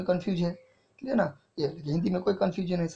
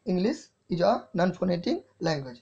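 slow dictation; much slower than usual. Shown as a demonstration. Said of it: "sorry".

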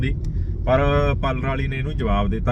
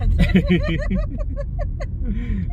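high laugh. Real, not acted. Husband laughs at wife's plan.

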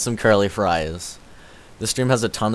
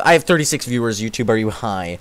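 ordinary talk

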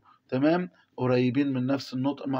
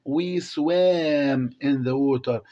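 slow reading aloud; 4 dB louder, much slower than usual. Shown as a demonstration. Teacher reading the text to the class, very slowly. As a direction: read each English word very slowly for the class.

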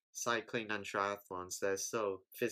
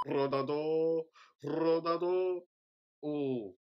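ordinary talk, nothing marked

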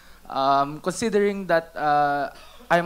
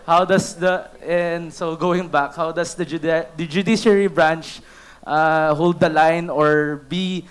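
nervous, shaky and a little louder; shaking voice; 4 dB louder than usual. Real, not acted. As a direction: push through the nerves with a trembling voice. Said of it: "I'm sorry if my voice is shaking because I'm so nervous".

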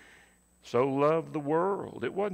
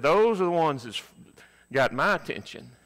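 louder, punchline delivery; mock alarmed soldier; 4 semitones higher, 7 dB louder than usual. Young soldier telling sergeant, louder with alarm. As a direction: raise it for the punchline. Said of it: "He said".

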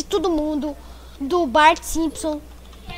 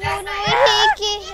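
panicking gamer; 5 semitones higher than usual. Real, not acted. Friend dying in game.

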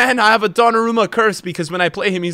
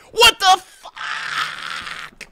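much higher, very slow and drawn out; stunned outburst; 13 semitones higher, much slower than usual. Real, not acted. Gamer reacting to a terrible pass, stunned drawn-out outburst. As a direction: stretch out the disbelief.

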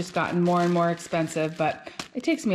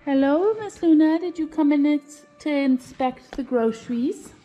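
pet talk; 7 semitones higher than usual. Real, not acted. Talking to the cat.

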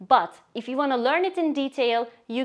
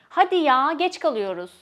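louder Hadi ya, impatient complaint; annoyed; 2 semitones higher, 3 dB louder than usual. Shown as a demonstration. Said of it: "especially when you're annoyed".

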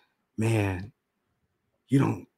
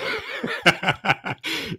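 breathy laugh; 8 semitones higher, 4 dB quieter than usual. Real, not acted. Laughing at wife's joke.

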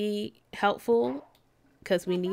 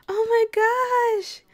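high, slow swoon; gushing coo; 11 semitones higher, 3 dB louder, slower than usual. Real, not acted. Gamer cooing at her newborn Sim twins. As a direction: melt over the babies.

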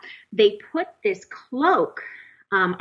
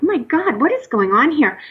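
higher-pitched exasperated disbelief; overwhelmed "what is happening" reaction; bewildered disbelief; 6 semitones higher than usual. Retold or imitated. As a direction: retell your bewilderment, pitch lifted. Retelling her bewildered reaction.

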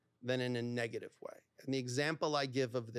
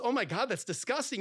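mock disgust; 7 semitones higher, 4 dB louder than usual. Partner reacting with disgust.

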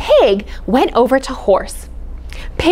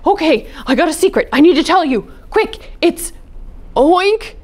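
high, hurried then slowing; Dog forgetting the secret; flustered; 6 semitones higher than usual. Acted. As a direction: fumble the secret anxiously.

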